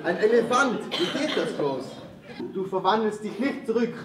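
loud taunting. Acted. Cat daring the ogre, loud and high. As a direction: challenge the ogre at full voice.

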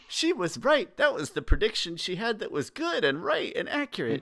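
high-pitched, emphatic protest; impassioned insistence. Real, not acted. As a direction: insist on her being vindicated. Impassioned defence of Aunt Wu.

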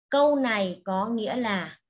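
normal talking voice

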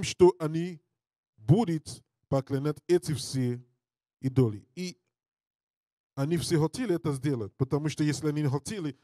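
ordinary talk, nothing marked

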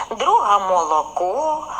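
usual speaking voice

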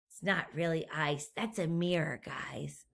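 soft whisper to the listeners; whispered aside. A narrator's reading. Teacher whispers a secret aside to the children. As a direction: let them in on the secret.

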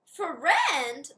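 loud shriek of a witch. Acted. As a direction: shriek it at them. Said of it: "the woman shrieked".

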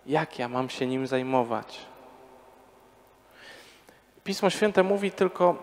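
usual speaking voice

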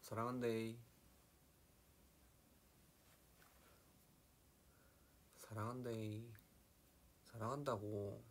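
lightly done Gyeongsang lilt; Gyeongsang dialect. Shown as a demonstration. Idol answering a fan's dialect request, light Gyeongsang accent. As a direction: say it with a hint of Gyeongsang intonation. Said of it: "사랑한다고 경상도 사투리로 해달라고요?".

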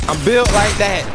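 hyped brag. Real, not acted. Bragging after a play.

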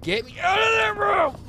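high, loud desperate yell; panicked shout. Real, not acted. Streamer begging to escape the haunted room, yelling in panic. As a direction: scream to be let out.

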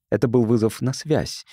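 ordinary delivery

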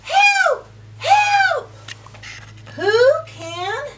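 high, loud cry for help; duck yelling for help. Acted. Duck stuck in the muck calls for help, loud high shout.

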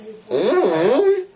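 high laughing question. Real, not acted. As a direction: laugh through the words. Laughs at being wheelchaired in.

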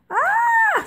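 very high, sustained "aah"; high scream. A narrator's reading. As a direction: squeal in alarm on one held high note. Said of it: "said Pete".

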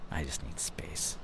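slow hushed whisper. Acted. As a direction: breathe the words out, barely voiced. Self-talk, remembering what he said.

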